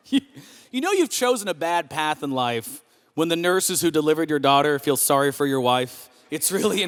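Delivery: usual speaking voice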